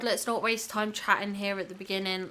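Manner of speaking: normal talking voice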